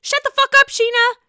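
loud, high yell at Scheana; angry rant. Real, not acted. Vlogger yelling at Scheana through the screen, loud and furious. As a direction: tell her off at full volume.

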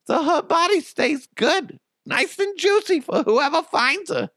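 very high, hammed-up New England mother; screechy mock mom with a broad New England accent. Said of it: "a New England accent".

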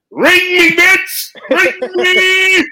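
high, loud taunting holler; boastful yelling. Pretend. Fan flaunting his team's ring at a rival, high taunting yell. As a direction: blast it out high and drawn-out.

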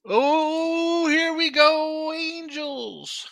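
very high, drawn-out sing-song; stretched high-pitched cry of here-we-go; mock wail. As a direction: wail it long, high and loud. Exasperated arrival at the Angels preview.

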